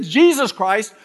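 loud, high, slow proclamation; dramatic preacher. Retold or imitated. Evangelist proclaiming the second coming, loud and slow. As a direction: thunder it like a revival preacher. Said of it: "And he said".

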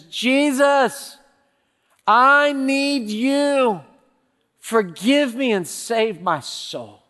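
loud, high, drawn-out cry to God; imitating anguished plea. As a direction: act out a broken man crying out in desperation. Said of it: "He sobs and sobs and sobs".

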